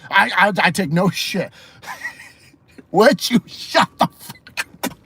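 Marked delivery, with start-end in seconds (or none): 2.9-5.0 high, slow mock yell through laughter; giggly mock outburst; laughing imitation. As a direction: crack up while doing the catchphrase. Imitating Sonya's catchphrase while laughing.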